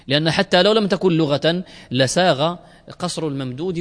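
ordinary talk, nothing marked

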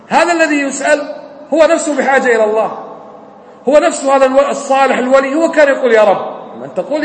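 impassioned Friday sermon voice, rising and falling; urging the congregation to call on God alone, preached with fervor; preaching. Acted. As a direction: preach it to the hall with conviction.

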